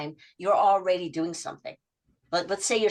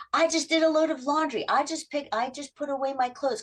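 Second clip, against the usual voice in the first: excited report; 8 semitones higher than usual. Retold or imitated. Client excitedly reporting chores.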